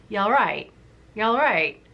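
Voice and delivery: light Yorkshire "You all right?", all and right run together; a lightly done Yorkshire greeting, falling at the end; Yorkshire accent. Shown as a demonstration. Explaining to Lizzie how locals say it, in a light Yorkshire accent. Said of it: "It sounded more like".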